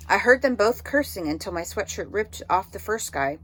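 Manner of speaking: usual speaking voice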